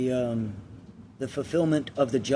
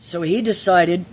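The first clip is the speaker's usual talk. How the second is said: louder, higher, emotionally charged parable telling; parable storytelling; 6 semitones higher, 7 dB louder than usual. Acted. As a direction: tell the fable with swelling emotion.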